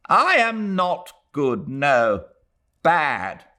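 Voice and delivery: mother insisting she is bad; old woman, bitter. A narrator's reading. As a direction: deny it heavily. Mrs Moore rejects praise.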